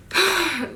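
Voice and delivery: high, quick exhale; exasperated sigh after ranting. Real, not acted. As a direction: blow out the frustration. Sighs after the rant.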